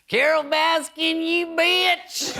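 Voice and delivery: very high, slow, drawn-out holler; hammed-up outraged yell, stretching each word; Joe Exotic. Comic auditioning to play Tiger King, hammed-up high shout. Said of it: "Here's my audition tape".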